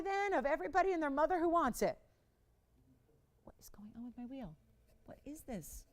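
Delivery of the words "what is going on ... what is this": puzzled whisper to oneself; whispered, off-to-the-side bewilderment; hushed aside. Real, not acted. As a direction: drop to a very soft, baffled murmur. Puzzled aside about the wheel.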